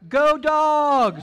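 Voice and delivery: big, high, drawn-out rallying yell; loud impersonation, shouted at full voice; Dwayne impression. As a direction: holler it like a fan cheering the team. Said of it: "I'll do my best Dwayne impression".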